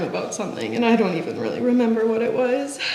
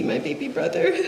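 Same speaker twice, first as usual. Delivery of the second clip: choked whisper; 4 semitones higher, 5 dB quieter than usual. Real, not acted. Eulogy for his younger brother.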